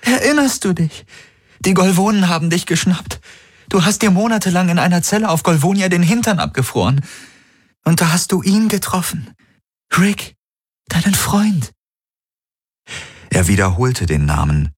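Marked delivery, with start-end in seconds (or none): 0.0-11.7 high, rising, desperate plea; pleading young woman. Acted. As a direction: beg him to remember. Kirai keeps pleading.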